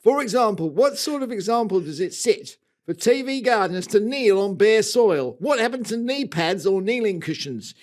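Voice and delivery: indignant mock-Australian rant, rising in pitch; Australian accent. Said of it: "and that is North Melbourne".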